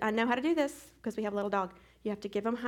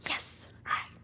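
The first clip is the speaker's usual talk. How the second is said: ordinary talk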